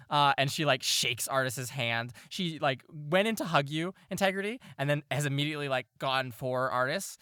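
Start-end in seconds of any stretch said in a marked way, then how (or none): none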